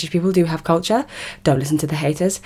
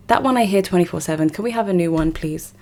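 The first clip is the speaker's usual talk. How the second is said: ordinary talk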